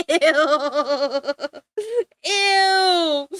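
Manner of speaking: loud, disgusted ew. Real, not acted. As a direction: recoil with a loud ew. Reacting to disgusting name.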